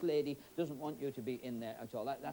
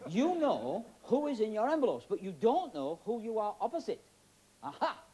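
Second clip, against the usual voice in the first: ordinary talk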